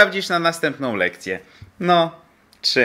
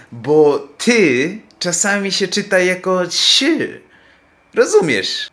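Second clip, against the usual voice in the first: explaining to a student; 4 semitones higher, slower than usual. Acted. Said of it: "Słuchaj".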